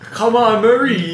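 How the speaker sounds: high, loud mock plea; hammed-up high imitation of a bad accent. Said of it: "'cause he went".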